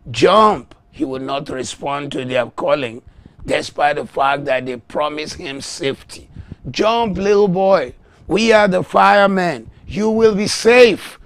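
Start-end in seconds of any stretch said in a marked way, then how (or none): 0.1-0.7 loud, raised shout of "jump"; acted firemen's scream. Preacher voices firemen screaming to the boy, loud shout. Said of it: "They are screaming to the boy". 6.7-11.2 loud, high urging from below; acted firemen's shouting. Firemen promise the boy safety, shouting loud and high. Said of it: "They are screaming to the boy".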